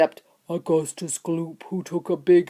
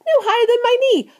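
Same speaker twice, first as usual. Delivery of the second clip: high, loud, rapid outburst; excited exclamation; 5 dB louder, faster than usual. A narrator's reading. Visitor rushing to see the little men, fast excited cry. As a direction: blurt it out fast in amazement.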